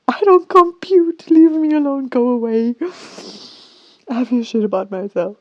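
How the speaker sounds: high, loud whining protest of a sulking brain; loud wail of a brain that wants to be left alone; whiny brain voice. Retold or imitated. Tired brain whines at her, loud and high. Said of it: "My brain is like".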